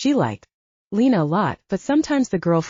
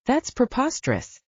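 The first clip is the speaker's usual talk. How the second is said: exclaimed; 7 semitones higher than usual. A narrator's reading. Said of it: "Portia exclaimed".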